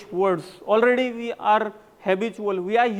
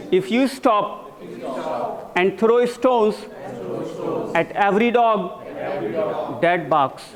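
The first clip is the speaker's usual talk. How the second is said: slow, raised chunks for the class to echo; repeat-after-me drill; 3 semitones higher, slower than usual. Shown as a demonstration. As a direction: dictate it slowly, phrase by phrase, with firm emphasis. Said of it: "all of you are repeating". (धीरे-धीरे, टुकड़ों में दोहरवाते हुए)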